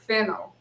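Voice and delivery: fennel as FEN-ul, falling; confirming pronunciation. Shown as a demonstration. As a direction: say "fennel" plainly with stress on FEN. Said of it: "you had it right".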